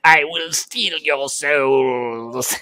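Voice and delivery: very slow, lightly voiced demon threat. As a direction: drag out a playful menace. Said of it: "That's how Kremble will talk from now on".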